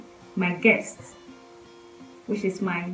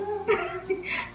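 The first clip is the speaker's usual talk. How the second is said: giggle; 12 semitones higher, 8 dB quieter than usual. Real, not acted. Laughs at friend's wording.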